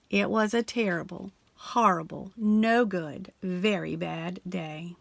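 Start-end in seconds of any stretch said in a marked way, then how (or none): none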